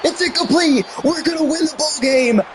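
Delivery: high, thrilled shout of victory; excited cry. Real, not acted. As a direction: celebrate winning the game. Game-winning pass falls incomplete.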